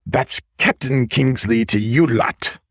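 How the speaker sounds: slow, growling pirate captain's bark. Acted. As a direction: play the swashbuckling pirate ambushing his friends. Pirate raccoon ambushes them.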